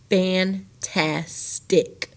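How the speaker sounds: fantastic pulled apart very slowly into three beats; syllable by syllable. Shown as a demonstration. Teacher to class, sounding out the divided word slowly. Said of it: "So my next syllable is tas".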